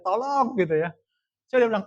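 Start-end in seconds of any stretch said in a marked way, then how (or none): 0.0-0.5 high, loud mock cry for help; acted-out distress yell; imitated shout. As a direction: call out to people on the shore. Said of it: "terus teriak sama orang di pantai".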